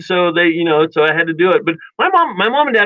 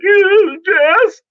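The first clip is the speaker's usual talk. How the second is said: high, loud wailing yes; squealed yes through fake tears; mock crying yes; 5 dB louder than usual. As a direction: cry out a pained yes like a hurting kid. Kid forced to agree, crying.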